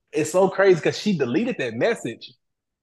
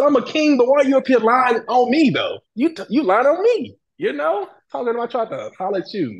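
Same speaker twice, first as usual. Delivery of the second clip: indignant retort; 10 semitones higher, 4 dB louder than usual. Retold or imitated. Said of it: "I said".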